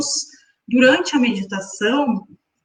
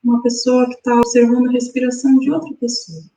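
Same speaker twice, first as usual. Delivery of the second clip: guided meditation. Acted. Guides breathing exercise.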